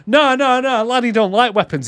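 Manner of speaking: frantic denial. Retold or imitated. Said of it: "And he's like".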